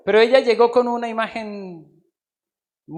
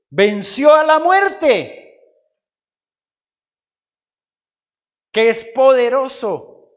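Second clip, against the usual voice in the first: loud, raised, drawn-out declaration; triumphant proclamation; 5 semitones higher, 9 dB louder, slower than usual. Real, not acted. Preacher declaring God's victory over death, triumphant. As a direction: proclaim it slowly at full force.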